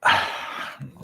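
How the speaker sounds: soft, airy release of breath; deep breathing exhale. Shown as a demonstration. Host leading another deep breath, demonstrated exhale. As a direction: breathe out audibly after a deep inhale. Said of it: "And an exhale".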